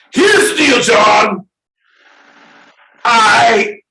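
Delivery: loud, high ranting call-out; shouting at John. Real, not acted. Ranting at John over kilts on Saint Patty's Day, loud. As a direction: yell it at him, half joking.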